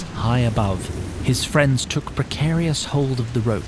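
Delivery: normal talking voice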